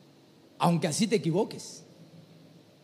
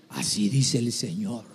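low, slow prophetic formula; solemn prophet voice; 10 semitones lower than usual. Acted. Preacher planning a prophecy for his brother, deep and solemn. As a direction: intone it gravely, far below normal pitch.

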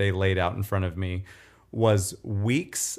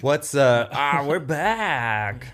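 a Ray Romano that slides into Kermit, higher and slow; Ray Romano attempt; 5 semitones higher, much slower than usual. Host copying cohost's impression, Muppet-like Ray Romano. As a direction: stretch it out like a Muppet trying Ray. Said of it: "Mine's more Kermit than, uh, Ray".

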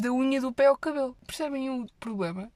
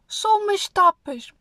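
higher, louder, slow and teasing; coaxing temptation; 7 semitones higher, 7 dB louder, slower than usual. Retold or imitated. A friend coaxing her to dinner, higher and teasing. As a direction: drag it out like a sly nudge. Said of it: "E manda logo aquela, aquela quinada de lado".

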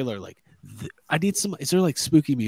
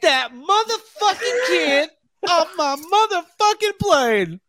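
Samuel Jackson yell; slower than usual. Retold or imitated. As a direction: bellow it like the movie. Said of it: "Snakes on a Plane".

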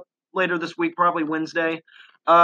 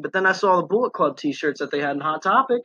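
ordinary talk

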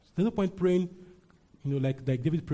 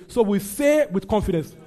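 loud, raised-pitch proclamation; confident declaration; 6 semitones higher, 8 dB louder than usual. Shown as a demonstration. Preacher restarting the verse with the congregation, loud and confident. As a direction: declare it firmly to rally the room. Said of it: "Say it with confidence right now".

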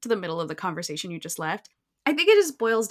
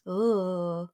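ugh. Retold or imitated. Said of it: "has this moment behind his back".

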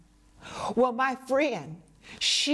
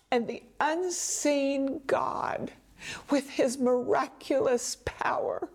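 whisper; 3 semitones higher than usual. Real, not acted. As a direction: hush it like a sacred secret. Preacher whispers about God's power.

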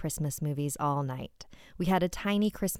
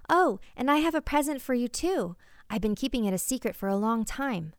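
excited; 7 semitones higher than usual. Acted. Child bursting with a surprise.

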